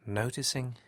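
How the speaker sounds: slow hypnotic delivery with long pauses; calm, slow meditation voice, lingering between phrases; guided relaxation. Acted. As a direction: ease the listener into rest, phrase by unhurried phrase.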